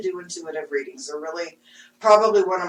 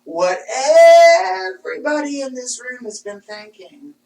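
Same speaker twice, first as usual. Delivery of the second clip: emphatic retort; 6 semitones higher, 8 dB louder than usual. Retold or imitated. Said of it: "And I'm like".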